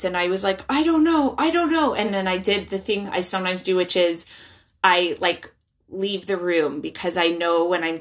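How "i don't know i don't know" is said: flustered. Retold or imitated. Mom's flustered answer retold.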